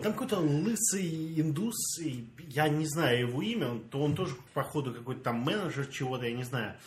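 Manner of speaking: usual speaking voice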